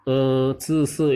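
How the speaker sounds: ordinary talk, nothing marked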